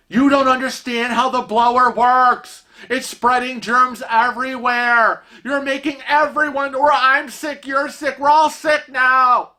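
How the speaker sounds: high-pitched hysterical rant; mock panicked screaming. Stranger ranting at husband about spreading germs, mock hysteria. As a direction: escalate like an imitated germ-phobic alarmist. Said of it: "he starts screaming at Kevin".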